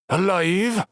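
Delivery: heavy, drawn-out drawl; thick drawl. Acted. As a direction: lean into a thick country drawl. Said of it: "in his thick drawl".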